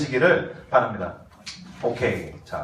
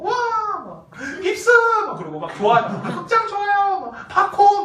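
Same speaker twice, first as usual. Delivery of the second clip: high, drawn-out thrilled cries; girlish delight; 10 semitones higher than usual. Retold or imitated. 어린 여자의 반응을 흉내: 신나서 감탄. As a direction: squeal over every treat. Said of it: "어린 여자들 다 처음 먹잖아".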